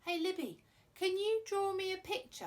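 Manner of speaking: calling out. Real, not acted. Calling to Libby off-camera.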